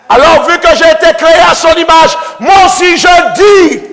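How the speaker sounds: loud, high chant-like proclaiming; fervent preaching. Acted. Preacher to congregation declaring alleluia, fervent shout. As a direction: rouse the congregation, repeating alleluia.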